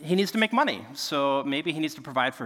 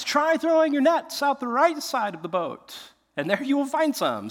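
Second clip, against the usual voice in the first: high-pitched acted call from shore; random shore guy; 10 semitones higher than usual. Random guy on the shore to fishermen, calling out. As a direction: call out advice in a higher voice. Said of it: "The random guy on the shore says".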